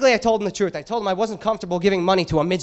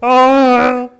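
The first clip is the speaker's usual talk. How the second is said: loud, grunting caveman; much louder, dumb caveman grunts; 3 semitones higher, 9 dB louder than usual. Retold or imitated. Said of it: "I'm like a caveman when I wrap".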